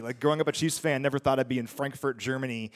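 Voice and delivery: ordinary delivery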